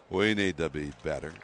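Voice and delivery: a hint of a southern cowboy manager; Bruce Bochy voice. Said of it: "and that's the way he talks".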